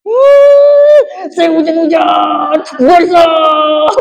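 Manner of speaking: high, loud, slow complaining shout; shouting about getting drenched again; mock angry outburst. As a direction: blow up like a soaked, fed-up man. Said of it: "Kira-kira Ibu Pendeta kalau dikasih begitu".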